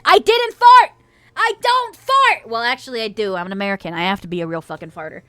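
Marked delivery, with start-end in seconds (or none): 0.0-2.5 high, loud protest, dragged out at the end; mock indignant outcry. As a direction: deny it in a raised, squealing pitch. Indignant denial of farting on mic.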